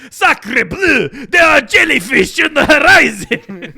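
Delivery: loud, high mock French accent. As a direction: shout it like a panicked Frenchman. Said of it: "I thought it was gonna sound like".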